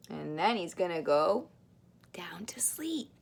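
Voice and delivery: hushed whisper. Acted. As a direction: whisper it sleepily. Falling asleep, whisper.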